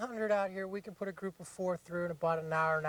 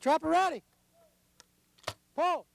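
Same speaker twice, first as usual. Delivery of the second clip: loud raised field call; 10 semitones higher, 6 dB louder than usual. Real, not acted. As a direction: shout it across the range. Guide calls to the trapper.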